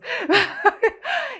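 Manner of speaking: a bright, high burst of laughter. Real, not acted. Amused at her own compromise, laughing high.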